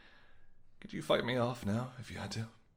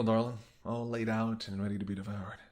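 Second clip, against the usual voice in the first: normal talking voice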